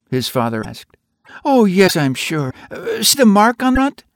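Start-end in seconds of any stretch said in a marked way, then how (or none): 1.4-4.0 put-on high, insistent child voice; a boy sure it's his, pointing it out; high child's voice, insistent; eager little boy. A narrator's reading.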